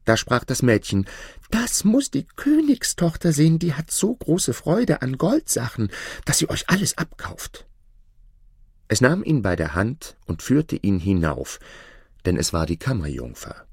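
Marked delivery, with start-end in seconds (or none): 1.5-7.7 put-on higher young maid's voice, eager; the maid lifting her voice to tempt the merchant; chambermaid. Maid urging the disguised merchant to the princess, higher eager voice.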